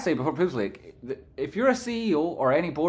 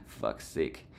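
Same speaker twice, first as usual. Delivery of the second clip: laughing groan; 12 dB quieter than usual. Real, not acted. Laughing exasperation.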